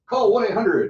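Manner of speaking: high, loud mock pitchman; TV ad announcer. Coach mocking an infomercial announcer, hammed-up. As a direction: boom it like an infomercial. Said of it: "that's like".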